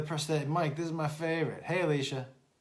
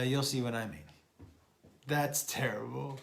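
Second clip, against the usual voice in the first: usual speaking voice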